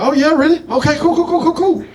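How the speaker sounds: high, excited falsetto agreement; squeaky cool cool cool, eager to please; mock eager agreement. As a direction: pitch way up, chirpy and eager. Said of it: "And I was like".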